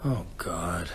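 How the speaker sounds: the breath knocked out of him; shocked hush. Acted. Hearing the bodies were stolen, a stunned low hush.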